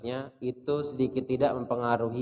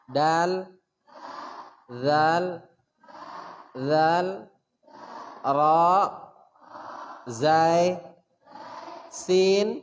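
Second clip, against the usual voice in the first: letter drill; 3 semitones higher, much slower than usual. Shown as a demonstration. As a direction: call each letter out for the class to repeat. Teacher drills letters for echo.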